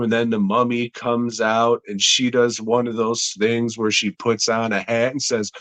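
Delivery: ordinary delivery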